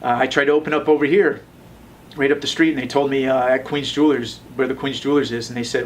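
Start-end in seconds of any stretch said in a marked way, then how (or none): none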